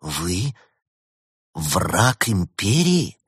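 halting, slow horrified question. A narrator's reading. Elena realises Ulysses is the empire's enemy, horrified. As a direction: draw it out slowly, aghast. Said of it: "Елена ужаснулась".

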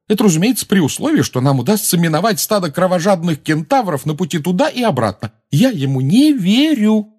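very high, loud indignant tirade; heated exclamation. A narrator's reading. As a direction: rant in outraged disbelief at a friend. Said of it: "горячо воскликнула она". (горячо, возмущённо восклицать)

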